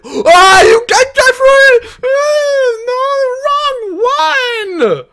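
very high, loud, pained shriek; real shrieking despair at the wrong card; anguished scream. Real, not acted. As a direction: scream like the pull betrayed you. Pack pull goes wrong.

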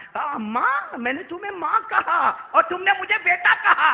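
high, loud preaching that climbs to a shout; impassioned sermon delivery; full-force preaching. Acted. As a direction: preach it out to the crowd, rising to a cry. Prophet's reply, preached.

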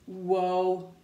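low, long, loud whoa on one note; wary whoa. Acted. Pigeon facing the bathwater, wary low whoa. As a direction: hold it low and level.

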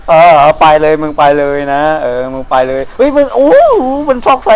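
loud, urgent shouting; shouting at zombies. Real, not acted. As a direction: shout them away in a panic.

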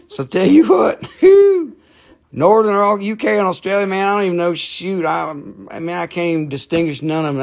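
high, hammed-up Southern accent; North Carolina accent. Shown as a demonstration. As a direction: get worked up in country North Carolina talk. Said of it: "my hometown language here".

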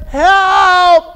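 high, drawn-out cry for help. Acted. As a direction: cry out as a drowning man would. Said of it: "So I cry out and say".

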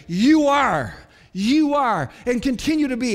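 "You are" punched loud, each time falling; emphatic. Acted. Peter declares who Jesus is, "You are" hammered emphatically. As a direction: hit "You are" hard as a firm declaration. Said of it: "He says emphatically".

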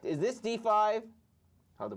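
ordinary talk

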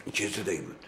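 soft, low suspicious murmur; father wary of a hidden sound; hushed question. Acted. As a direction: ask under your breath, listening. Father hears a noise.

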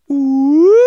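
siren ooh up, down, up; siren wail. Shown as a demonstration. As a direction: trace an N with a siren. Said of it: "We go up, we go down".

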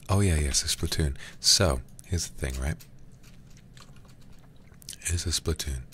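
ordinary talk, nothing marked

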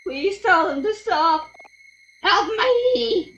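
very high, loud desperate cry; shrieked, acted terror; terrified screaming plea. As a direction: scream for help in panic. Game character screaming for help.

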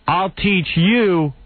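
hammed-up menace, stretched slowly; threat. Photographer threatening the host, slow mock menace. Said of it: "and the guy goes".

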